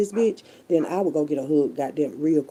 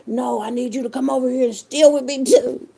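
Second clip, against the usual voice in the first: mocking a relative; 8 semitones higher, 5 dB louder than usual. Mock voicing of a relative.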